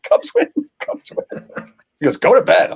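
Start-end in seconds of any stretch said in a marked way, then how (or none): 0.0-1.5 high, drawn-out cheer kept down in volume; half-voiced falsetto celebration; mock yell. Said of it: "she hears me yelling". 2.2-2.7 raised, louder mock scolding; punchy higher-pitched retold command; wife's sharp order. Wife's retort in retold quarrel.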